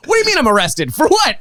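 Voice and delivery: mock shocked protest climbing high; mock indignant. Arrested tourist protesting to police, mock shocked.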